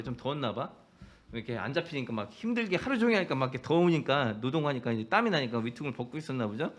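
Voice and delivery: ordinary talk, nothing marked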